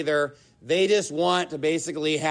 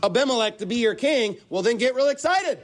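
mock celebration climbing ever higher; sarcastic; 5 semitones higher than usual. Preacher voicing Jotham mocking the men of Shechem, sarcastic. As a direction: build to a high, over-bright fake cheer. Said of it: "This is sarcasm, folks".

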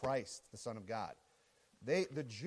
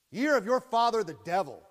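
forceful preaching; 7 semitones higher, 11 dB louder than usual. Retold or imitated. Jesus's strong preaching, forceful.

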